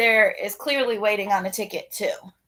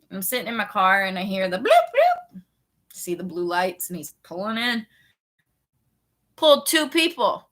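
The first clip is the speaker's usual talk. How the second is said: ordinary talk, nothing marked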